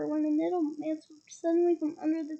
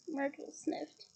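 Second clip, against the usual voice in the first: plain reading voice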